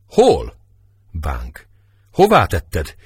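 demanding shout. A narrator's reading. Bánk demands of Tiborc where Melinda is, shouting. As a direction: hurl the question at him.

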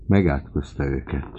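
usual speaking voice

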